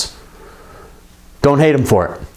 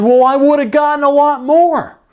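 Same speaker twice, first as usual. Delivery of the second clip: upset daughter; 9 semitones higher than usual. Retold or imitated. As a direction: whine it like a disappointed kid. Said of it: "And she said".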